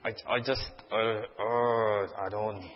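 shy people dreading outreach, mumbling; reluctant shy person. Retold or imitated. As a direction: groan then falter and fade. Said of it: "the normal people like me, are like".

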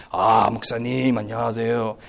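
low, soft deferential greeting; imitated reverent churchgoer, pitched low; respectful Korean greeting.

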